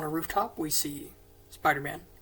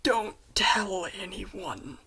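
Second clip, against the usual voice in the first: reluctant admission; 3 semitones higher, 5 dB louder, slower than usual. Retold or imitated. He admits it, asks secrecy.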